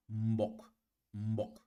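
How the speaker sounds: low-toned "Mbok". Shown as a demonstration. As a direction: drop the voice on each "Mbok". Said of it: "je vais faire un abaissement dans la voix".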